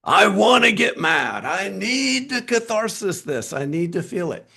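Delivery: hammed-up moan of wanting to get mad; frustrated inner part.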